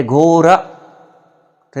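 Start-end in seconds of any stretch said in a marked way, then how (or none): 0.0-0.6 loud घोड़ा parroted as "gora"; pupils echoing the mispronunciation. Acted. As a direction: chant back घोड़ा with R for ḍ. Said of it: "तो हम कहते". (मास्टर की नकल में बच्चों का ज़ोरदार घोड़ा, ड़ की जगह र)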